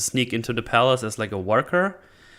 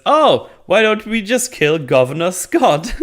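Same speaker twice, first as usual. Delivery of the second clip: breezy, higher and louder proposal; mock casual suggestion; 9 semitones higher, 7 dB louder than usual. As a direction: toss it out like it is nothing. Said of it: "And he just says".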